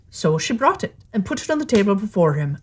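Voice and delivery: ordinary delivery, nothing marked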